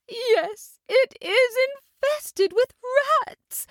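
high, slow, tearful mother cat; lamenting the rats in her house. A narrator's reading. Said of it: "said Tabitha tearfully".

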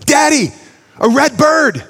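put-on high, eager child voice; a four-year-old pointing at a bird; squeaky toddler delight; excited little boy. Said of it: "he looks up and he says".

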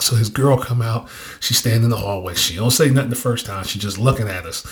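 ordinary talk

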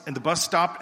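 usual speaking voice

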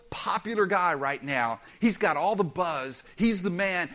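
usual speaking voice

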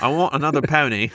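prim British. Acted. Speaker sends up the prim set, prim British. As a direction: play at being prim and proper British. Continues mocking the prim accent.

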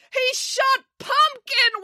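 very high, slow wail. Acted. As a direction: wail it out in grief. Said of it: "wailed April".

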